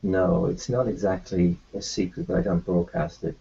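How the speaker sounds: usual speaking voice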